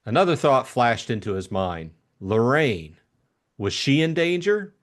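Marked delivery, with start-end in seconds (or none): none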